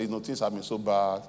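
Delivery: low, soft hard-luck tale; put-on downcast begging; mock poor-me plea. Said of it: "You now turn your head like this".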